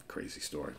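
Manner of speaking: breathy whispered aside. Shown as a demonstration. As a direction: whisper it to yourself. Whispered then repeated aloud.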